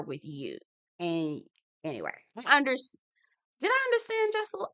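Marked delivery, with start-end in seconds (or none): 3.6-4.7 very high, quick squeaky question; high-pitched, rushed mock doubt. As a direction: ask yourself in playful falsetto.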